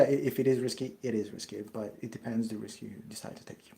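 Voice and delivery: ordinary talk, nothing marked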